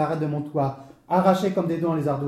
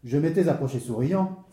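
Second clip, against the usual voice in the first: usual speaking voice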